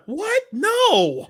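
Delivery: very high-pitched actor impression; mock actor denial. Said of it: "Mark, you're out of breath".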